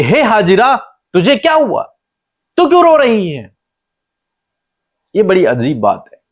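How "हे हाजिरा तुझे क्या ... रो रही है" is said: higher-pitched, concerned angel calling out. Retold or imitated. Angel calls to the weeping Hagar, raised concerned voice. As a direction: ask it as the heavenly messenger, pitched well up. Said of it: "यहां पर स्वर्गदूत उससे कहते हैं कि".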